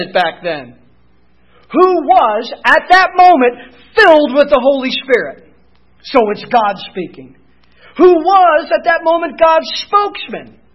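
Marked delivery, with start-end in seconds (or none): 1.6-5.5 emphatic preaching. Real, not acted. Preaching climax. 8.0-10.6 emphatic preaching. Real, not acted. Preaching climax.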